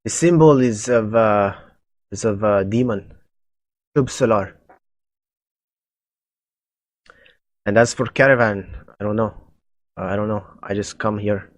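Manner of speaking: put-on halting, light Russian accent. Captive cultist answering the party's questions, Russian accent. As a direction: stammer it out with a slight Russian accent. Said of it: "a Russian, little bit of a Russian accent".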